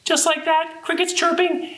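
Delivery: very high little voice; playful high-pitched squeak; tiny cricket voice. Pretend. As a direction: pipe it small like a cricket. Demonstrates tiny crickets for the children.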